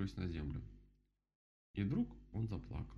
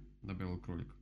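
ordinary delivery

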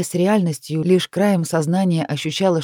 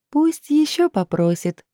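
much higher, slow playful spirit voice; Lilith joking; 10 semitones higher, slower than usual. Acted. Lilith teasing Kai, high and playful. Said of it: "шутливо возразила Лилит".

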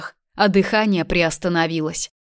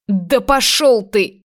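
hissed defiance; 4 semitones higher, 4 dB louder, slower than usual. Acted. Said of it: "прошипела она сквозь зубы".

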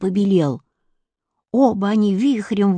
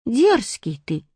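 offended; 4 semitones higher, slower than usual. A narrator's reading. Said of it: "сказал он обиженным голосом".

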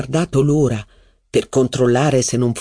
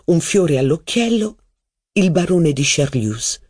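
ordinary delivery

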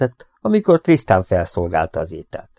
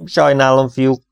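plain reading voice

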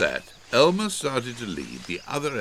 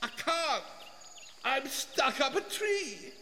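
high, soft, far-off voice; small high-pitched distant voice; voice from above; 8 dB quieter than usual. A narrator's reading. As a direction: call down thinly from up high. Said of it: "Wilbur's voice said from above them".

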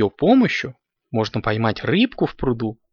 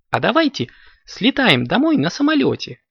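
high-pitched, lilting kitten voice; eager kitten's suggestion; 10 semitones higher than usual. A narrator's reading. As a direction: pitch it up as a child proposing a plan. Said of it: "предложил решение Коржик".

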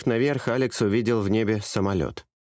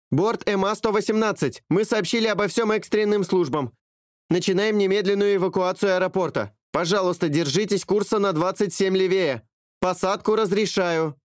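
much higher, crisp radio dispatcher; female dispatcher voice; 10 semitones higher than usual. A narrator's reading. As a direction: answer like an air traffic controller. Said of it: "женский голос ответил". (высокий голос диспетчера по рации)

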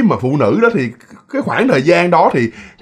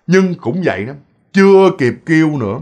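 normal talking voice

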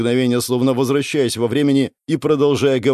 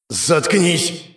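raised, sudden angry yell; outburst at himself; 6 semitones higher than usual. Acted. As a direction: yell to silence a voice in your head. Yells at his ghostly double.

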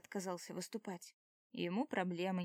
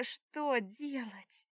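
slow, drawn-out voiced «что делать?» with a falling end; voiced lament; 3 semitones higher, much slower than usual. A narrator's reading. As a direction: moan «что делать?» aloud, slowly and despairingly. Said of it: "О боже".

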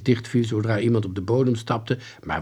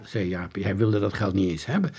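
ordinary delivery

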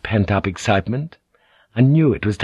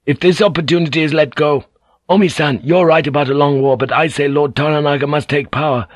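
higher, quick and insistent; impassioned young samurai; 6 semitones higher, 3 dB louder than usual. A narrator's reading. Son defending his plea to the council, insistent. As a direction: plead the case with fire.

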